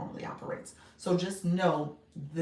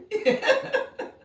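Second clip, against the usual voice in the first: a real giggling shriek; delighted high-pitched cackle; high squealing laugh; 9 dB louder than usual. Real, not acted. Laughing at her own joke.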